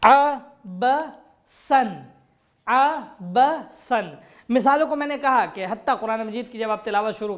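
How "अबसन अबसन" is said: slow drawn-out अबसन ending -san; Quranic recitation. Shown as a demonstration. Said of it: "कुरानी मिसालों की तरफ तवज्जो कीजिए". (धीमे तिलावत अंदाज़ में अबसन)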